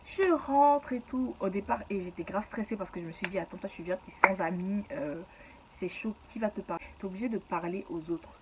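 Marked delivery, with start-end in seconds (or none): none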